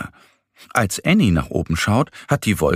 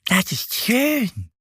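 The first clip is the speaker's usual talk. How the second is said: higher, slow, lightly voiced bear; little bear Mo, pleased; slower than usual. A narrator's reading. Bear Mo admiring a pink cloud, pleased. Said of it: "Er zeigt ... und lächelt".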